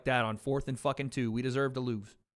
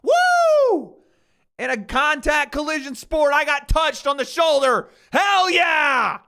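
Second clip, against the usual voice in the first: much higher and louder, hammed-up celebrating jock; mocking celebrating kicker; 12 semitones higher, 11 dB louder, slower than usual. Streamer mocking a punter who celebrates a penalty, high and loud. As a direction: ham up a dumb gloating cheer.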